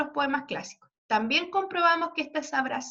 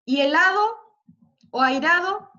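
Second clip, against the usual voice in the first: louder, slow helado and airado; rhyme words stressed; 3 semitones higher, 6 dB louder than usual. Shown as a demonstration. Teacher marking rhyme C for students, slow and loud. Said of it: "Y hacemos la C".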